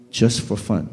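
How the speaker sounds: soft, low inner voice; lightly voiced calm divine voice; inner voice of God. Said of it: "听到里面有个声音跟我说". (低沉轻柔的内心声音)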